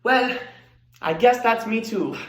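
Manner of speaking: loud, high answering call; calling back. Acted. Child answering mother from the kitchen, loud and high. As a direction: holler a reply to another room. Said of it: "I would respond from the kitchen".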